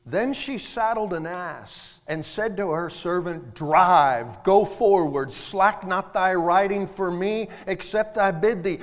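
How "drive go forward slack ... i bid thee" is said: loud, forceful order; commanding, emphatic scripture quote; urgent command. Acted. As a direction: drive the servant on with punched words. Woman ordering her servant to hurry.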